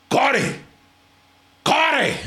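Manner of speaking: high-pitched imitated call for someone; calling across gym.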